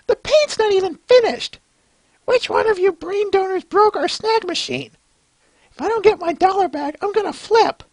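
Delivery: high, put-upon cartoon character complaining; squeaky cartoon pony. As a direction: whine and squabble over the toy. Voices comic characters squabbling.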